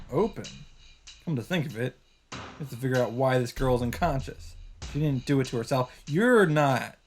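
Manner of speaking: usual speaking voice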